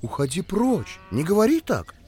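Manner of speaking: high sharp cry to shoo; shouting. Acted. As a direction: call out to shoo the dog. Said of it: "крикнула".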